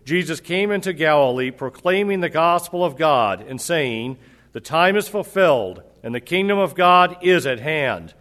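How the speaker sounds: formal, measured gospel reading; scripture reading. Acted. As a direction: read it out as the Gospel lesson. Reads the gospel lesson.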